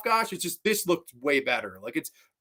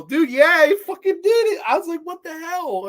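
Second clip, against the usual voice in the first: excited; 6 dB louder than usual. Real, not acted. Laughing retelling bug scare.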